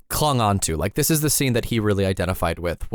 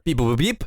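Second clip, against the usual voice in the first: mock computer beeping; robot beeps. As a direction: computer noise beep boop.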